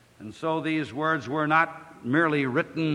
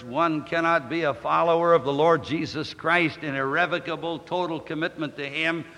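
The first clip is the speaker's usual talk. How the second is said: ordinary talk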